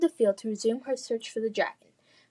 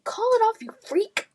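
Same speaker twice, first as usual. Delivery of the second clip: high, loud frantic shout; panicked yell; 12 semitones higher, 5 dB louder than usual. Acted. Adam yelling at Lily in panic, high loud shout. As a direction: scream at her to stop it.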